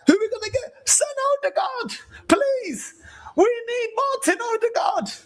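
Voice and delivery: high, wailing hammed-up sob; mock crying plea. Villager wailing for Odegaard to save them, hammed-up crying shout. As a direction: cry out for Odegaard like the village is lost.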